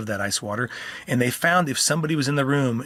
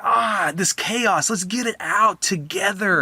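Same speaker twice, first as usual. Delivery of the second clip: slow, high, playful call to chaos; playful rallying; 6 semitones higher, slower than usual. Retold or imitated. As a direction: invite the child to let loose together. Playful attunement with angry child.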